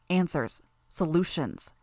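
plain reading voice

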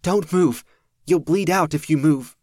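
high, loud pleading cry; urgent shout. Acted. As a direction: yell at him to stay still. Marissa begs wounded man.

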